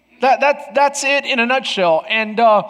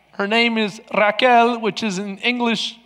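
ordinary talk, nothing marked

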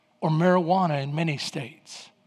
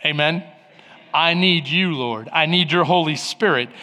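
ordinary delivery

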